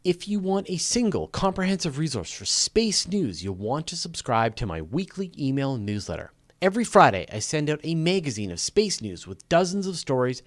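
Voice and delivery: upbeat sign-off read to the audience; announcer outro. Acted. Host wrapping the live show for viewers, upbeat announcer read. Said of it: "I'll do the other outro".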